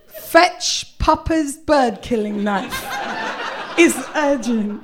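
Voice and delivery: very slow, deadpan, grave delivery; mock serious. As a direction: drag each word out with fake urgency. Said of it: "my face was very serious".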